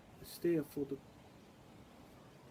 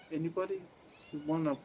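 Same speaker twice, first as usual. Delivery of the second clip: ordinary talk, nothing marked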